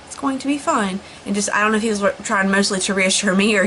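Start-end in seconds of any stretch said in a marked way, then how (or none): 0.0-1.0 calm reassurance. Retold or imitated. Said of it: "Justin being calm".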